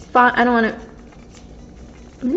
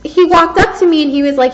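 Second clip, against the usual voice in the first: normal talking voice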